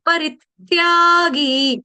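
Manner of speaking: परित्यागी drawn out slowly, stress on री; slow syllable-by-syllable demo. Shown as a demonstration. Teacher sounding out a Sanskrit word for the class, slowly. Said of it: "ये word आपने सुबह भी सीखा था".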